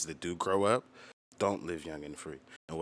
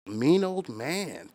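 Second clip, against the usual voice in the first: slow, high and louder, dragging each word; slow emphasis; 8 semitones higher, 7 dB louder, much slower than usual. Real, not acted. Emphatic slowed phrase.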